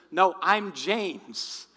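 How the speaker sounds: loud, slow, stretched-out retort; insistent retort. Retold or imitated. Mugger corrects his victim right back, insistent retort. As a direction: insist slowly and loudly on your own name.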